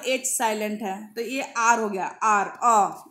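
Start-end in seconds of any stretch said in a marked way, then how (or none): 2.0-3.1 आर stretched into a long drawn-out अ sound; आर said very slowly and a little louder, showing the अ sound; sound demo. Shown as a demonstration. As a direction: drag out the vowel of आर so its opening sound is heard. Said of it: "यानी ए का sound दे रहा है".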